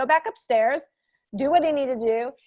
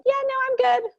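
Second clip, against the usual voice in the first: far higher, airy and unbothered; breezy refusal; 3 dB louder, much faster than usual. Retold or imitated. Parent declining a child's fight invitation, breezy and high. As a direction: brush off the invitation lightly.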